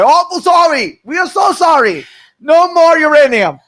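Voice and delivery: loud, high mock Iranian apology; bad Iranian accent. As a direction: plead in a hammed-up, badly faked Iranian accent. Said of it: "that was my Iranian accent".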